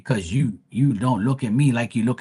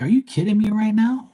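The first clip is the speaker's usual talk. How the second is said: retold disbelief; 8 semitones higher than usual. Retells reaction to viewer's complaint.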